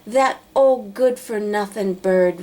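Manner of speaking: usual speaking voice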